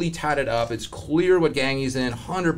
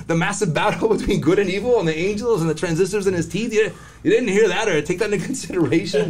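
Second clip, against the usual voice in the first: incredulous; 7 semitones higher than usual. Retold or imitated. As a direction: plead in disbelief with a laugh. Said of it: "I'm like".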